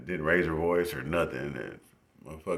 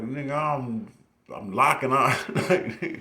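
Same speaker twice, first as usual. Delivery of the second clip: exasperated protest; 8 semitones higher, 5 dB louder, much slower than usual. Retold or imitated. His own retold protest.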